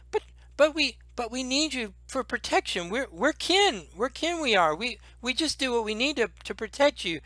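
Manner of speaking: high, soft pleading little kobold voice; kobold Meepo. Acted. DM as Meepo begging the dragon to stay, high timid pleading.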